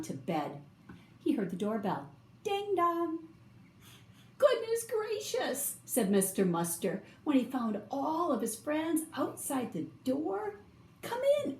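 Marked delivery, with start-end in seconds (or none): 2.4-3.2 high, chiming ding-dong; doorbell imitation. A narrator's reading. Narrator sounding Mister Muster's doorbell, in a high chime. 4.4-5.8 high, slow exclamation of amazement; delighted surprise. A narrator's reading. Mister Muster finding his friends outside, in high surprise. 11.0-11.6 very high, excited invitation; eager welcome. A narrator's reading. Mister Muster inviting his friends inside, high and eager.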